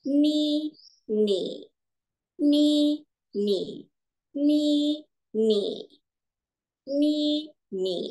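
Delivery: teacher demo of ni in tones one to four; Mandarin tone drill. Shown as a demonstration. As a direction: model each tone of ni clearly. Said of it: "nada satu dia paling tinggi".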